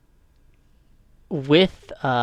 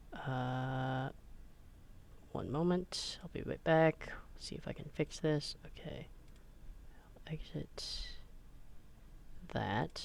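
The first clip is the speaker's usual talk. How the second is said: whispering; 17 dB quieter than usual. Real, not acted. Muttering off-mic while fixing audio.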